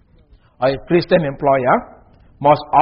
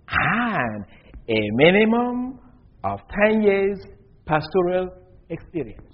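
slow, measured quotation of an advertisement; slow reading; 2 semitones higher, slower than usual. Real, not acted. Preacher quotes a church's advertisement so listeners catch each phrase, slowly. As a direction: read it out slowly, phrase by phrase with long pauses. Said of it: "I'm going to read it slowly".

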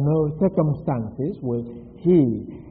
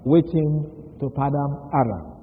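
usual speaking voice

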